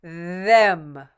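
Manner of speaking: normal talking voice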